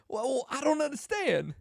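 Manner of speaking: high, halting, puzzled celebrity voice; hammed-up impression, baffled by the offer; Christopher Walken. Movie star rejecting offered shoes, baffled impression. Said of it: "he's like".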